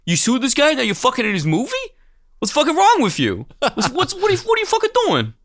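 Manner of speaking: high-pitched incredulous mock outrage; exasperated rant, pitch shooting up; angry Paul Sorvino. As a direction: berate them in a hammed-up tough-guy voice. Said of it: "Fucking Paul Sorvino's just in there being like".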